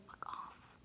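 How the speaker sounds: barely audible, voiceless whisper; hushed imitation of a dismissive look; whispered dismissal. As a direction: mouth it under your breath. Voices the man's silent look.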